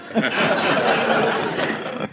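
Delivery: long unvoiced chuckle; host laughing. Real, not acted. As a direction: laugh at your own joke. Host laughs at his joke.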